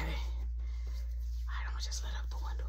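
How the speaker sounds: soft unvoiced whisper; whispering while sneaking out. Sneaking out the window.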